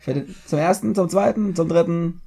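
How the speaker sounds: high, rising auction call; going once, going twice; auctioneer. Pretend. Auctioning off his points.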